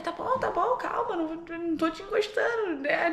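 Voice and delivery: high-pitched backing off; hurried placating. Retold or imitated. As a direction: reenact hastily calming a panicked child. Placating the screaming child.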